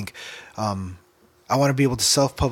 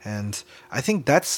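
ordinary talk, nothing marked

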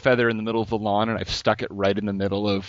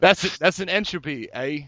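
put-on higher-pitched, botched Aussie accent; bad Australian accent; 5 semitones higher than usual. Host jokingly using a supposed Aussie slang word, bad Australian accent. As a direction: attempt Australian and slip toward Dutch. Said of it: "I don't know how to do the Australian accent".

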